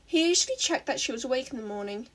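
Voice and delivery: plain reading voice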